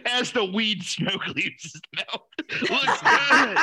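laughing while teasing. Real, not acted. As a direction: giggle through the words. Teasing friend about vaping.

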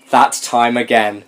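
usual speaking voice

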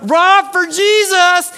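very high, loud, stretched yell; imitated yell. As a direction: holler it from the field like a fan. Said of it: "he'll just call it out".

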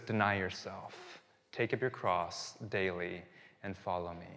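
Jesus calling the listener, quiet and solemn; solemn Jesus quote. Acted. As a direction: say it gently and slowly, like a quiet command. Jesus voiced, solemn command.

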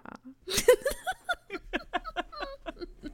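high, wheezy giggle trailing on; real high-pitched cackling laugh; real laughing fit. Real, not acted. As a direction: laugh helplessly, high and long. Long laughing fit after joke.

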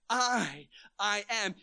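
long, loud "I", a pause, then "I am"; emphatic I. Shown as a demonstration. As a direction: punch out "I" twice, high and loud. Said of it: "it's emphatic".

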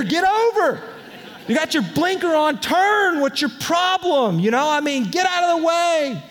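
high, frustrated yelling at other drivers; mock road rage.

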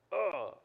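conflicted groan. Retold or imitated.

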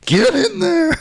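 high, hammed-up American-sounding holler; a botched, high American-like voice; mock American accent. Host imitating a woman from Sunderland, sounding American instead. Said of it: "She's not American".

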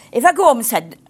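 ordinary delivery

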